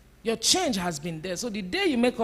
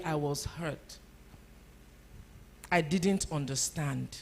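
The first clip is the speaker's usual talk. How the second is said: soft, hurt confession, much softer; gentle admission; 5 semitones lower, 5 dB quieter than usual. Shown as a demonstration. Preacher showing families how to admit hurt, soft and gentle. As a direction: quietly own up to the hurt. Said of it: "Teach your family that it's okay to say".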